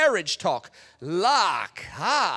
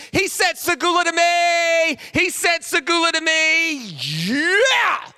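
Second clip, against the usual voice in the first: much higher, louder, giddy; squealing excitement; 10 semitones higher, 8 dB louder, slower than usual. Retold or imitated. Pam squealing to her friends, mock giddy. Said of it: "She calls her three best friends".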